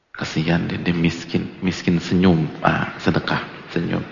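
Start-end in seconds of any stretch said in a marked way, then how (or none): none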